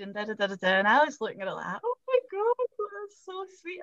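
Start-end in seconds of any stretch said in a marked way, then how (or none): 1.8-3.8 touched gushing. Retold or imitated. Touched reading a friend's letter.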